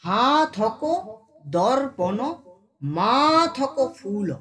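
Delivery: slow, swelling "হা" and "মা" in "হাতক", "মাথক"; drawn-out syllables. Shown as a demonstration. Teacher exaggerating the verse's stretch, vowels drawn out. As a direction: hold "হা", "মা" and "ফু" very long. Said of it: "টানছি এখানে".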